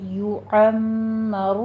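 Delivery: یعمر drawn out very slowly with nasal ghunna; recited word. Shown as a demonstration. Teacher reciting یعمر as a tajweed model. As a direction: chant یعمر slowly with the doubled meem. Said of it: "لو میں واولین ادا کریں گے".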